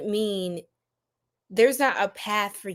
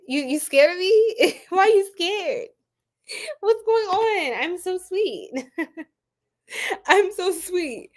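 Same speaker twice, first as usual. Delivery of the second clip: high, drawn-out innocent plea; playful protest; 7 semitones higher than usual. Real, not acted. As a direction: plead sweet innocence in a higher voice. Playful protest at being called scary.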